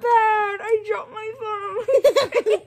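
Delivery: high laughing yelp; laughing in pain. Real, not acted. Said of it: "it hurts so bad".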